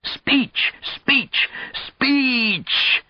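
very high falsetto chant, last one drawn out; mock-accented yell. A narrator's reading. Old friend demands a speech from him, high comic yelling. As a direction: egg him on with squeezed high calls. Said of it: "Arkady yelled".

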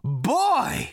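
high, loud exclamation; excited shout. A narrator's reading. Prack exclaims over meeting Arthur, shouting giddily. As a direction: burst out in delighted amazement. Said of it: "he shouted".

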